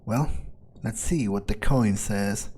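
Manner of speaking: ordinary delivery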